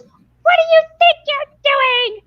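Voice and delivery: very high-pitched, squeaky little gnome scolding; angry gnome. Acted. Hiker imitates gnome scolding intruder at its home, high-pitched indignation. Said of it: "this little gnome goes".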